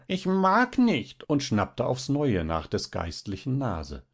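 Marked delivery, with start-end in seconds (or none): 0.1-1.3 very high, drawn-out creature voice; snarling refusal. A narrator's reading. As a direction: squeal it out slowly like a cross little imp. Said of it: "aber Zaches knurrte". (hoch und gedehnt geknurrt)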